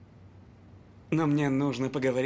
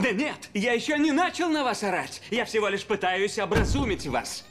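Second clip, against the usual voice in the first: raised voice; 9 semitones higher than usual. Acted. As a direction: push hard, voice still raised. Exec insists, still shouting.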